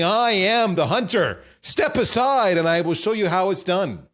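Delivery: loud, high chest-thumping boast; boastful warrior. Retold or imitated. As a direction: puff up and proclaim it.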